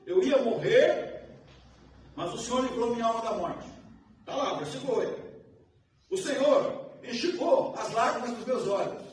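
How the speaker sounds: preacher's voice rising to full force; fiery preaching. Acted. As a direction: proclaim it to the congregation. Preaches the psalm.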